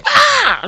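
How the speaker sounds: very high, loud scream of rage; angry scream. Retold or imitated. Worker following coworker outside, retold angry scream. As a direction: let out a frustrated yell. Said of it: "just getting more and more angry".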